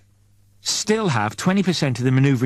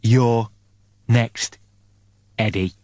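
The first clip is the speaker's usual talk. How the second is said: menacing message; much slower than usual. Acted. The killer's scrawled promise to Eddie, ominous. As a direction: let each word hang darkly.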